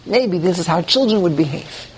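ordinary talk

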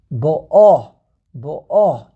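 "Ba'a" with the second syllable hit loud; loud teacher's demo, "Ba'a" stressed at the end. Shown as a demonstration. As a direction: punch the final "a" of "Ba'a". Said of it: "You put the emphasis on the second syllable".

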